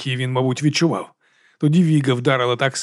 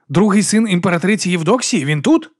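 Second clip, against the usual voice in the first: raised-pitch alarmed question; 5 semitones higher, 3 dB louder than usual. A narrator's reading. Brother Diaz learning the duke is near, alarmed. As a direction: ask with mounting alarm.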